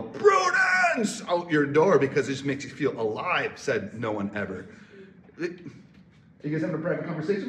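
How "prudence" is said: high, loud yelled name. Pretend. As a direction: shout it out the door. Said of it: "you wake up and you yell".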